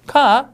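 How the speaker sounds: "카!" with no R, vowel held long and called out loud; British car. Shown as a demonstration. Teacher calling out a passing car, long and loud. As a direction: stretch "카!" into a long loud "kaa". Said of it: "이렇게 하시면".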